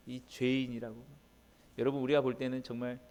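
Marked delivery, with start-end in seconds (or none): none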